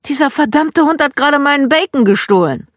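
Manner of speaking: loud, high-pitched indignant rant; high, loud voiced fury; furious outburst. A narrator's reading. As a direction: holler it as an outraged dad. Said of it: "Er war so verdammt sauer".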